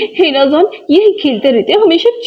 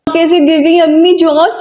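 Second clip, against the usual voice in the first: normal talking voice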